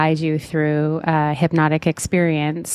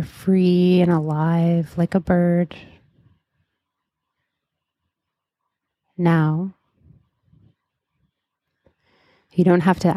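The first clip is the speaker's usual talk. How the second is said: guided meditation. Acted. Leads a hypnotic guided meditation.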